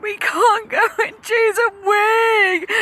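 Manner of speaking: high, loud tearful wail; high loud childish sob; crying son. Retold or imitated. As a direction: wail it like a sobbing little boy. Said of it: "he said".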